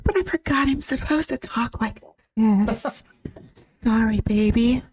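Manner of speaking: high, put-on little girl voice. Streamer mock-apologizing to viewers who disliked her voice, little girl voice. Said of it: "You want me to talk like a little girl".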